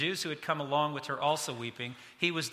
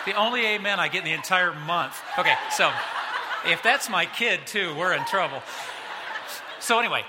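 laughing through the words, louder; 3 semitones higher, 7 dB louder than usual. Real, not acted. Pastor laughing with congregation at his bad-dad joke. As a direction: chuckle through it at your own joke.

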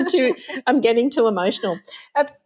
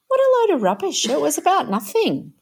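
dismissive scoff; 8 semitones higher, faster than usual. Retold or imitated. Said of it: "My first reaction was".

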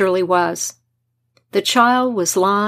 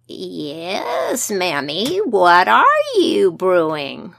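put-on changeling old man voice; 2 semitones lower, much slower than usual. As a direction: draw it out, ancient and sly.

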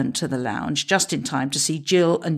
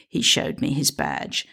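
usual speaking voice

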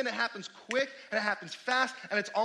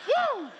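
high, loud joyful woo; a real burst of glee; excited whoop; 6 dB louder than usual. Real, not acted. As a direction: whoop with delight. Excited exclamation after answer.

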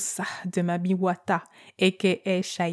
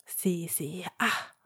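conspiratorial whisper that trails off; whispered aside; 7 dB quieter, much slower than usual. Real, not acted. Host hints to listeners about the ugly fiancé, whispering. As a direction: whisper it like a secret.

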